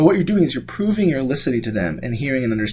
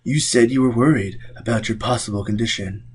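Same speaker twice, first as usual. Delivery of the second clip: reading practitioner. Shown as a demonstration. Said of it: "example I have here of the practitioner".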